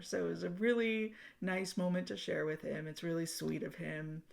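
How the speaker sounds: ordinary talk, nothing marked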